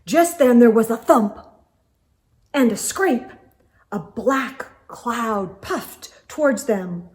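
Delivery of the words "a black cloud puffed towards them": plain reading voice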